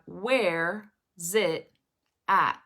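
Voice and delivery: "where is it at" slowly, as where / zit / at; slow teacher demo, "where is it at" in three beats; three separate words. Shown as a demonstration. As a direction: gaps between each chunk of "where is it at". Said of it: "imagine this being three words".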